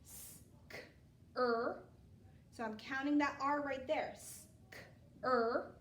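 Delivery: s, k, er, each sound said apart. Shown as a demonstration. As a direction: segment the word into sounds. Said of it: "sound 'em out".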